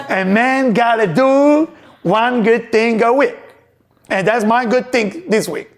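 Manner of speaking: 느리고 높게, 낯선 남자 흉내로; voicing the kind stranger. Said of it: "That's what he said".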